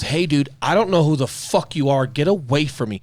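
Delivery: mock cop telling him off. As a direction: bark it like a fed-up cop. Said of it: "And that cop goes".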